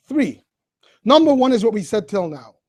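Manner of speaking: usual speaking voice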